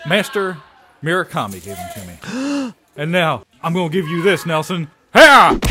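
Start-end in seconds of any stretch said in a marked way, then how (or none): 5.1-5.6 karate yell. Acted. Kicks Nelson.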